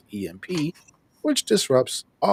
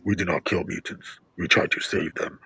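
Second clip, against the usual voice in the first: lower, put-on official voice; mock stern soldiers; 6 semitones lower than usual. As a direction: voice the troops, low and matter-of-fact. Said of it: "they're like".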